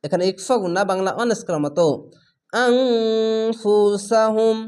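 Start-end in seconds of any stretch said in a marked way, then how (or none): 2.5-4.6 slow drawn-out tajweed chanting; Quran recitation. Shown as a demonstration. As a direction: recite it slowly, stretching the vowels. Recited word with nasal ghunna.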